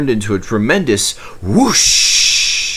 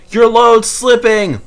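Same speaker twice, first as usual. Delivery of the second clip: loud, much higher warning shout; 14 semitones higher, 7 dB louder than usual. Acted. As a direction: call out in alarm. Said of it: "one shouted".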